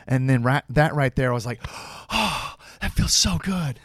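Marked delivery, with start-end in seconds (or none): 1.6-3.8 slow, high, melting "so good"; touched and flattered. Retold or imitated. Actor retelling how the director's praise felt, slow and high. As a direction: let it sink in, slow and pleased. Retold reaction to Ben's speech.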